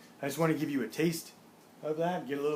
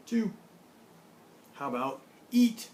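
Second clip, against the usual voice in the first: usual speaking voice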